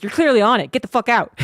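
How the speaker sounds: mock-tough cat ordering cats out; bossy cat. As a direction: shove them aside with your voice. Cat bossing other cats away.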